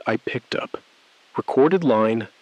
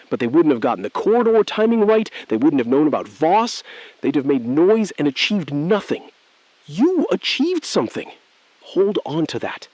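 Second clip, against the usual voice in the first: higher, louder, pressing the point home; emphatic; 6 semitones higher, 4 dB louder than usual. Acted. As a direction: push it hard and fast, almost lecturing. Rising heated persuasion.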